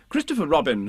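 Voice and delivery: ordinary delivery, nothing marked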